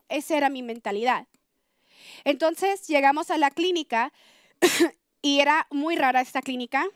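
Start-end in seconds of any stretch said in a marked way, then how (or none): none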